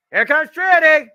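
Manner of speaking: loud, high-pitched announcer shout; excited entrance call. Real, not acted. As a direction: belt out the champion's arrival. Announcer calls out champion's entrance.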